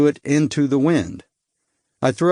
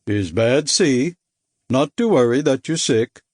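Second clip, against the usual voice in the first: put-on Baltic accent. Giant sailor comforting the seasick narrator, light Baltic accent. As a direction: reassure him in a mild Baltic accent. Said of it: "His accent was hard to place".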